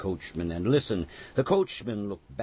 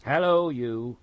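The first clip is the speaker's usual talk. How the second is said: hailing call; 7 semitones higher, 6 dB louder than usual. A narrator's reading. Calling out in the mist.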